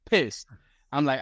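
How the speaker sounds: normal talking voice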